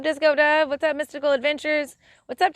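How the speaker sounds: ordinary talk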